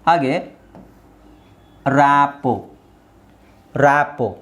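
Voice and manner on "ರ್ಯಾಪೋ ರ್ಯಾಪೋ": ರ್ಯಾಪೋ ending on an open "po", T silent. Shown as a demonstration. Teacher models ರ್ಯಾಪೋ for learners, silent T. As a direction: draw out ರ್ಯಾಪೋ with no final T. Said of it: "ಟಿ silent ಆಗಿರುತ್ತೆ".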